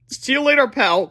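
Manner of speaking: high, loud doomed goodbye; boy's farewell. A narrator's reading. Boy bids friend farewell, high and loud. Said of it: "said Harold".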